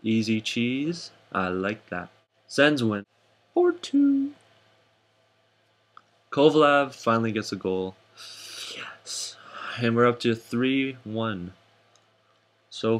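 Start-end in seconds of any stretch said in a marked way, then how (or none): 3.5-4.3 very high, silly sing-out; high squeaky voice. Acted. Host announcing the Sens win, high squeaky voice. 8.8-9.4 hushed, whispered celebration; whispered cheer. Acted. Host relishing Kovalev's goal, whispered cheer.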